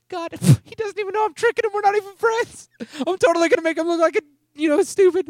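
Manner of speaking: put-on high, loud little-kid squeal; squeaky, excited child voice; five-year-old child. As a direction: imitate a small kid gleefully confiding in God. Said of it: "like, five years old".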